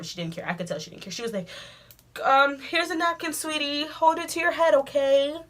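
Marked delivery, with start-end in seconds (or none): 2.2-5.4 higher, slow, falsely soothing voice; a light mock of an insincere sweet secretary; fake-sweet secretary. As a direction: sound caring while not caring. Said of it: "acted like she cared".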